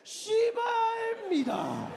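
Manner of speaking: high, slow mock-formal curse; polite Korean curse. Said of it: "they're still real polite".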